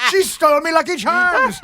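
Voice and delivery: high, hammed-up Irish brogue; Irish accent laid on thick for a laugh; mocking DiCaprio's Irish accent, high and hammy. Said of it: "I'm working on the accent".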